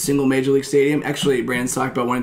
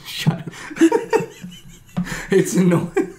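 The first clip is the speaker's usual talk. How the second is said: laughing; 7 semitones higher, slower than usual. Real, not acted. Laughing while mock-complaining.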